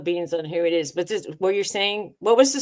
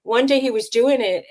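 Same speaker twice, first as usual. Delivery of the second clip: normal talking voice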